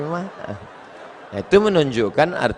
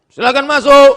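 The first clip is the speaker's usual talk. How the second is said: stern examiner; 9 semitones higher, 11 dB louder than usual. Retold or imitated. Voices the test examiner.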